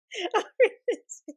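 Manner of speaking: high-pitched breathless giggle of nervous joy; a squeaky, overwhelmed burst of laughter; high giggling laugh. Real, not acted.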